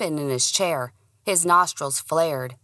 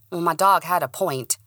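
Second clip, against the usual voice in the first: usual speaking voice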